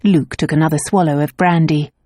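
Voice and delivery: usual speaking voice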